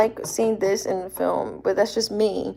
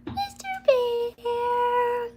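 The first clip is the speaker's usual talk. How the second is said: much higher, very slow, softer coo; squeaky pet call; 3 dB quieter, much slower than usual. Real, not acted. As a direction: squeal the name to the dog. Calling dog in pet voice.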